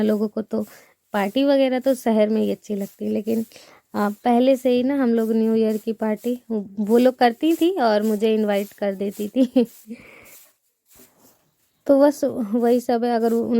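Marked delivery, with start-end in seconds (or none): none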